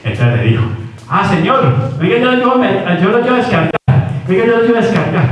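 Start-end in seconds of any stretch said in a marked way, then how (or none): 1.1-5.2 put-on high, eager boyish voice; a simple boy offering help; eager simpleton. (voz aguda y ansiosa de bobo)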